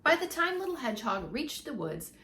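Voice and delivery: ordinary delivery, nothing marked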